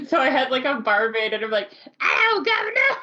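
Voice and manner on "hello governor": high-pitched, hammed-up Cockney shout; barmaid's hopelessly fake Cockney greeting; bad Cockney accent. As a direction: squawk it in a terrible Cockney accent. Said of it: "I can't do any accents".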